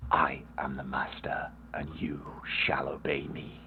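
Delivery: hypnotic villain command; villain whisper. Pretend. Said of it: "That's what I was willing you to say".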